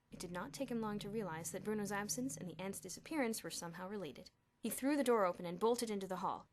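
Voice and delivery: usual speaking voice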